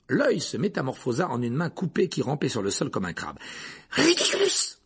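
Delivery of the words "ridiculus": high-pitched spell cry; called-out spell. A narrator's reading. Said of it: "lança Dean". (incantation lancée très aigu)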